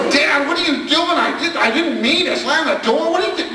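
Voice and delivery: high, laughing mock panic; hammed-up high, panicked kid yelling. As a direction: shriek it like a frantic teenager. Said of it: "the kid going ballistic".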